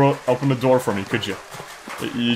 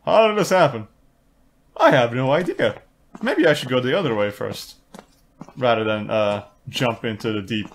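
ordinary talk, nothing marked

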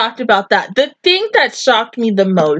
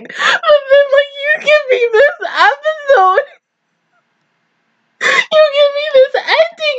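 much higher, tear-choked voice; tearful gushing; 11 semitones higher than usual. Real, not acted. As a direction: gush about her while crying happy tears. Overwhelmed crying over character.

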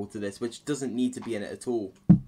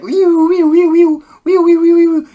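tiny high-pitched robot squeal, much higher and louder; squeaky robot; 17 dB louder than usual. Retold or imitated. Reviewer mocking the egg guardian robot, high loud squeaks. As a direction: chirp like a silly little machine. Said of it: "the robot's like".

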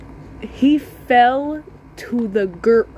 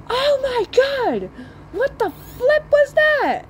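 high, slow shocked squeal; stung shock; 13 semitones higher, slower than usual. Retold or imitated. Boy stung by a bee, squealing in mock shock. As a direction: yelp like a kid just stung.

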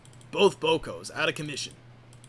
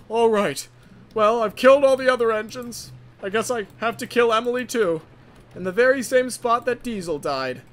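loud villain musing on the kill; gloating killer; 6 semitones higher, 7 dB louder than usual. Acted. Rogue signalman plotting to kill Emily, loud villain voice. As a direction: proclaim the killing with relish.

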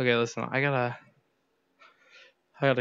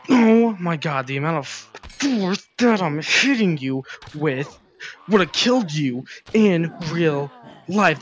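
exasperated rant; 4 semitones higher, 4 dB louder, slower than usual. Real, not acted. Exasperated at enemy's toughness.

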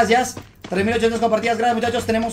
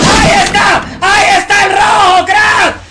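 high yelled callout, much louder; excited shout; 10 semitones higher, 11 dB louder, slower than usual. Real, not acted. Gamer spotting the enemy, shouting excitedly to chat. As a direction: yell it out as you spot him.

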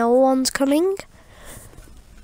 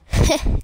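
chuckling. Real, not acted. Laughing at dead teammates.

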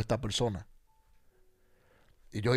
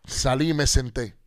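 ordinary delivery

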